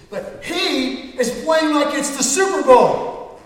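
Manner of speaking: slow, high, loud yell; angry complaint. Retold or imitated. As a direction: drag out the complaint loudly. Player complains loudly, retold.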